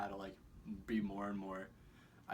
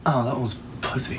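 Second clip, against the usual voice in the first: burping the words; 4 semitones higher, 19 dB louder than usual. Acted. Big burp while talking.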